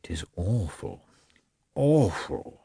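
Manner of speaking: husband muttering in despair; muttered. A narrator's reading. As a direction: mutter it to yourself. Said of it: "he muttered".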